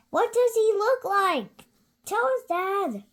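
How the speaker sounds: put-on high, eager child voice; a curious little monster asking Dad; Gruffalo's child voice. Child begging Dad for the story, high and eager.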